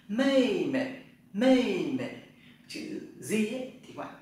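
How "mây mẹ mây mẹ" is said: mây mẹ said high and loud, falling; Chinese tone drill. Shown as a demonstration. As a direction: belt out mây mẹ as a model. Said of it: "Tập lại".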